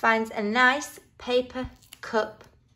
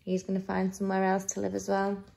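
low, soft, quick commentary; hushed aside; 3 semitones lower, 5 dB quieter, faster than usual. A narrator's reading. Reader to children as friends part, hushed. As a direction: murmur it on an even, low note.

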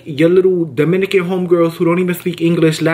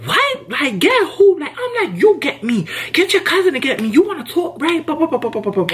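mock outrage, very high; high shouted what to the coworker; retold retort; 10 semitones higher than usual.

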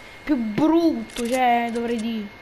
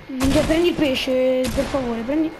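usual speaking voice

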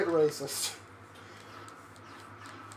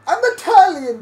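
stagey soliloquy in mock-Italian; cartoon Italian shaman announcing himself; 10 semitones higher, 8 dB louder than usual. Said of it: "Italian shaman".

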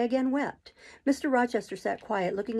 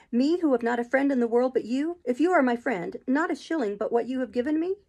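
higher-pitched, emphatic disbelief; Jane, incredulous; 4 semitones higher, 3 dB louder than usual. Acted. Friendless Jane doubts her master's proposal, incredulous. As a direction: question him in astonished protest. Voices Jane's incredulous reply.

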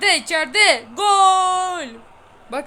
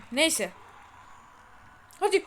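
ordinary talk, nothing marked

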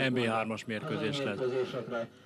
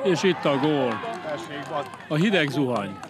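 excited hungarian sports commentary; 5 semitones higher, 7 dB louder than usual. Real, not acted. Goal call in live commentary.

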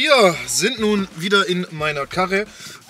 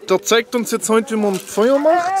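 TV-Moderator; 5 semitones higher than usual. Pretend. Moderiert gespielte Survival-Sendung.

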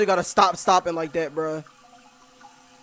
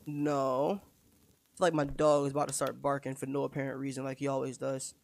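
usual speaking voice

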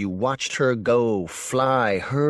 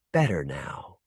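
soft reassuring; 7 semitones lower, 5 dB quieter than usual. A narrator's reading. Healer's calm assurance.

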